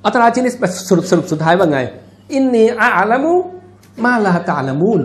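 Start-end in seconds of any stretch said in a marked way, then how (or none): 2.1-5.0 measured, melodic Arabic recitation; intoned sacred verse; Quran recitation. Acted. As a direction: recite the verse with long drawn vowels.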